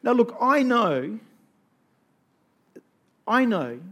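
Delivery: loud, rising sermon call; rally the congregation, voice ringing; fervent preacher building up the crowd; preaching at full force. Acted. Sermon exhortation.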